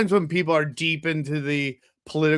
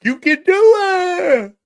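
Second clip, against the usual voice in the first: high, loud, slow cry of encouragement; big hammed-up rallying shout; cheering yell; 7 dB louder, slower than usual. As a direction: roar it like a coach from the stands. Cheering on sober fan.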